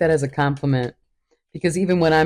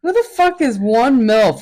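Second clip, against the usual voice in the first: loud incredulous quote; 8 semitones higher, 6 dB louder than usual. Retold or imitated. Quoting own reaction.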